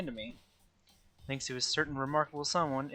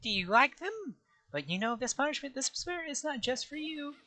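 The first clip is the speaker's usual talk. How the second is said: high, gleeful cartoon bear voice; gloating squeaky mascot with a laugh; Monokuma voice; 12 semitones higher than usual. Plays Monokuma.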